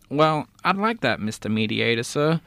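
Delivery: normal talking voice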